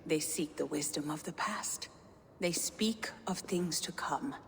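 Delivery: eerie breathy whisper; a secretive, otherworldly whisper. Acted. Gunnlodr whispering to Havi about old magic, eerie.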